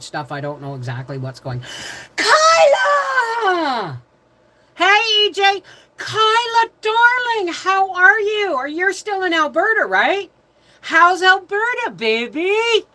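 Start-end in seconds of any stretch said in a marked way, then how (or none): 2.2-4.0 high, loud, long-held name; excited calling out. Real, not acted. Calls out to arriving viewer. 4.7-12.8 very high, loud, sing-song hello; gushing greeting. Real, not acted.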